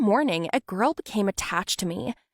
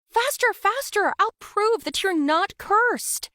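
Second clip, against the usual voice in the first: much higher, loud, hurried then slow and firm; urgent excited call; 12 semitones higher, 4 dB louder than usual. Acted. Friend pulling her out of the operating room, urgent and excited. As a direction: urge her out the door.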